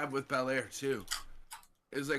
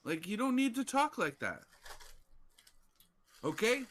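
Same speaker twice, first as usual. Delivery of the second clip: same words repeated higher and more pointed; insistent, higher; 8 semitones higher than usual. Real, not acted. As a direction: press the point, pitched up. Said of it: "you know, like".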